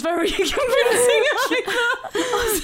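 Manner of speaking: high giggly voice; laughing at her own excuse. Real, not acted.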